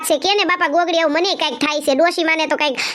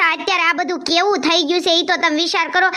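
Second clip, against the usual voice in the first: normal talking voice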